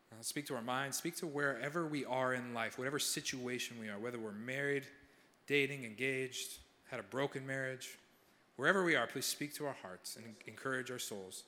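soft, earnest prayer voice; sincere pastoral prayer. Acted. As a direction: pray quietly over the room. Leads the congregation in prayer.

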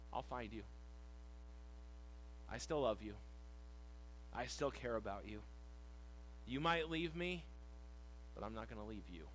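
low, soft, loving promise; tender reassurance. Acted. Jesus to disciples who will leave him, tender reassurance. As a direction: reassure gently, pausing between promises.

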